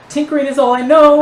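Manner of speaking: high, loud plaintive protest; terrified groveling. Acted. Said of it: "he's still just crying".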